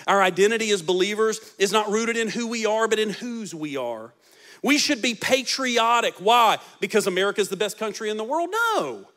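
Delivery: normal talking voice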